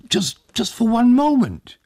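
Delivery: put-on high, fussing old man's voice; a fussing host pressing hospitality; old Tag, eager host. A narrator's reading. Old Tag urges the hussars inside, high and eager.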